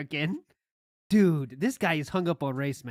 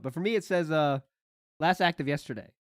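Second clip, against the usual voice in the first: normal talking voice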